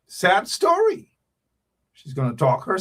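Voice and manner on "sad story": high, slow, drawn-out lament on "sad story". Real, not acted. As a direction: stretch it out high and mournful. Repeating the sad news.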